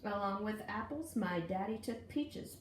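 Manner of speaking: plain reading voice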